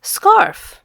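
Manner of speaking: ordinary delivery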